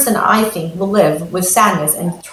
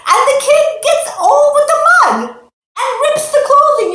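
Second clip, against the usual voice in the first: dramatic storytelling; 4 dB louder than usual. A narrator's reading. Kid ruins new clothes.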